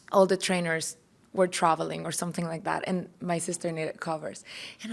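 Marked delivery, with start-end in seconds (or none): none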